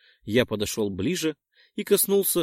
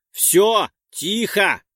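much louder, higher shout; near full shout; 8 semitones higher, 7 dB louder, much slower than usual. Acted. The narrator shouts to stop the brawl, near full shout. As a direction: shout to end the fight. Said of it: "чуть ли не во весь голос прокричал я".

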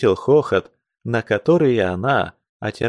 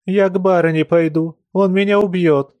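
tearful; 7 semitones higher, 3 dB louder than usual. A narrator's reading. Crying woman flees.

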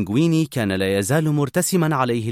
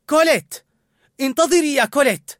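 much higher and louder shout after her; calling out; 13 semitones higher, 6 dB louder than usual. A narrator's reading. Linguini calling after Colette as she leaves, shouting. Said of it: "صاح لينغويني".

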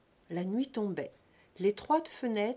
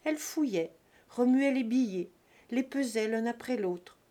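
usual speaking voice

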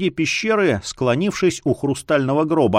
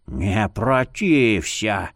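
put-on slow, hushed old man voice; old man whispering; 5 semitones lower, much slower than usual. A narrator's reading. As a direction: whisper it like a creepy elder. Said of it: "шептал старикан".

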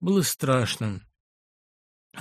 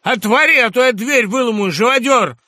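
much higher, loud threatening yell; pounding shout; 9 semitones higher, 10 dB louder than usual. Acted. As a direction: threaten to break the door. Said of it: "постучал кулаком в дверь".

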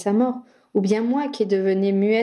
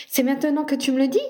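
higher-pitched indignant surprise; exclaiming; 6 semitones higher, faster than usual. A narrator's reading. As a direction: exclaim it as if just finding out. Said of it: "s'écria Ginny". (exclamation étonnée, plus aiguë)

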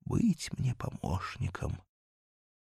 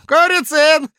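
calling out; 15 dB louder than usual. Acted. Calling out to subordinate.

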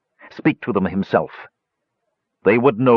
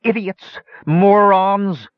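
high shrill shriek; 12 semitones higher, much slower than usual. A narrator's reading. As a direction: shriek the insult at them. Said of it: "he shrieked".

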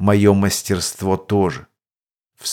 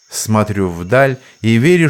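ordinary delivery

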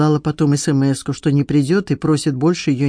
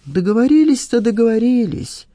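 higher-pitched, grudging concession; reluctant admission; 7 semitones higher than usual. A narrator's reading. Cornered man admits the arrangement to his questioner, reluctantly. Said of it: "неохотно признался Скамейкин".

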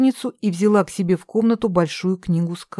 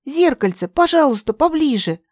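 нетерпеливая просьба; 8 semitones higher, 3 dB louder than usual. Acted. Умоляет зеркальце.

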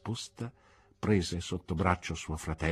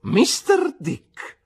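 "Mister Dick" drawn out slow, much higher and louder; triumphant exclamation; 10 dB louder, much slower than usual. Acted. Aunt praises Mister Dick's answer, slow triumphant exclamation. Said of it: "esclamò trionfante mia zia".

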